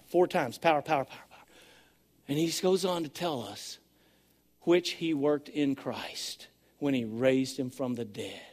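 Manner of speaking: soft, low, awed sermon voice; a hushed preacher dwelling on Christ's power; hushed preaching. Acted. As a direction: bring them in close, quietly.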